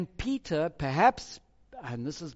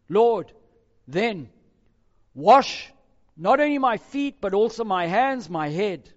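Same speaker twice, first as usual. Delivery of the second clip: eager plea; 4 semitones higher, 10 dB louder than usual. Acted. Peter begs to be washed.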